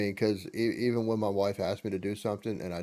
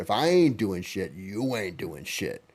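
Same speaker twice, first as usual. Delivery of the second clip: louder, slow and firm, "sit your ass down"; mock stern order; 6 dB louder than usual. Husband telling his wife to rest, loud and slow. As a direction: lay down the law to her, drawn out. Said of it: "I said".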